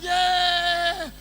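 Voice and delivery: stretched shouted cheer; crowd cheer. Retold or imitated. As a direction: cheer the name like an excited crowd.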